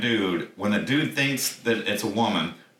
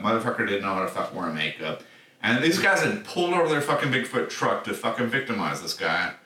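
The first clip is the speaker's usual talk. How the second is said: normal talking voice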